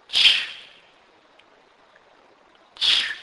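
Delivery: isolated "ch" as in church, loud burst; "ch" alone, a strong unvowelled burst; CH sound demonstrated. Shown as a demonstration. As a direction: model a bare, forceful "ch". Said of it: "It is pronounced as".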